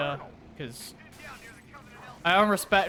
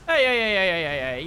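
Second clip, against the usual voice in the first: high, loud rapid-fire objection; loud protest; 4 semitones higher, 7 dB louder than usual. Real, not acted. Player objects to being lasered, loudly protesting. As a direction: call out in alarm to stop them.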